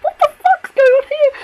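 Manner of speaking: high, loud, shrieking disbelief; outraged shriek. Real, not acted. Fan shrieking at the screen over the snowmen, real outrage. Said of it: "like, my expression".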